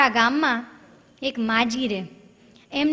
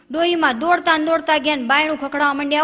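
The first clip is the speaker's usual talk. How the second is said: usual speaking voice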